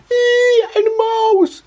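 very high, loud shriek; woman's scream. A narrator's reading. Woman spotting a mouse, shrill scream. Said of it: "schrie die Stimme einer Frau".

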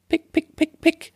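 very high chirpy pick sounds; bird pecking. Acted. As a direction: peck it out like a little bird. Täubchen picken die Linsen. (hohes Picken wie ein Vöglein)

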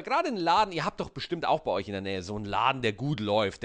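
usual speaking voice

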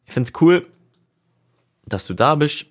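high, bright burst of enthusiasm; excited. Retold or imitated. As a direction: throw the line up high like a greeting. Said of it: "hab ich gesagt".

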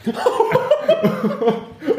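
squealing laughter; high laugh. Real, not acted.

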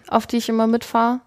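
usual speaking voice

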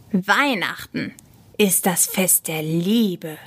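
slow, emphatic declaration; measured, earnest correction; solemn insistence. A narrator's reading. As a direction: state it slowly and with weight. Michi solemnly defends Christmas.

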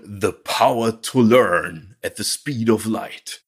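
slow, epic trailer narrator; movie trailer voice. Retold or imitated. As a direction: announce it like a blockbuster trailer. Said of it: "U-S-Kinotrailer-Stimme". (getragene Kinotrailer-Stimme)

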